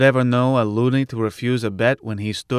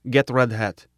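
ordinary delivery

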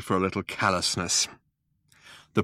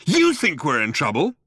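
incredulous; 6 semitones higher than usual. A narrator's reading. Arthur echoes incredulously.

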